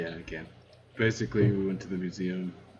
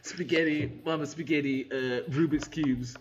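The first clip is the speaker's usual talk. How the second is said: high, hammy mock Italian accent; 7 semitones higher than usual. Riffing with the table in a higher mock Italian accent. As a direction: toss off foods in a cartoon Italian accent. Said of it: "talking like a foreign person".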